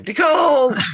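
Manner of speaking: high, playful holler; calling out. Acted. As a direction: call the name out for a far-off friend. Calling out to distant companion.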